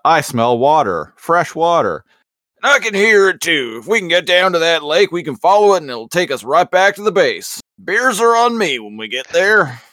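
big, booming, upbeat pep talk; hearty Rhinox voice. Retold or imitated. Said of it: "Rhinox is all like".

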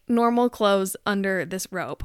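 ordinary delivery

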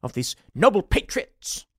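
high, loud, hammed-up outraged voice; shrill mocking of an offended critic, laughing; mock indignant critic. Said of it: "I've heard the argument that".

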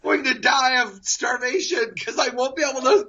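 high-pitched wailing, played for laughs; mock crying. Fired young father-to-be driving home, hammed-up crying. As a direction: imitate a panicked, tearful wail. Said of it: "crying, literally in tears".

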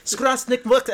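put-on high, loud made-up Eastern European accent; mock Slavic salesman accent, pitched right up; Crazy Vaclav accent. Fan quoting the car seller's country saying, mock Slavic accent. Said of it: "That is a pretty good accent".

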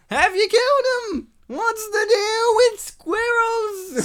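high, loud, slow Seinfeld stand-up whine; Seinfeld as villain. Retold or imitated. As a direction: ham up Seinfeld as Fearless Leader. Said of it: "if Seinfeld was Fearless Leader".

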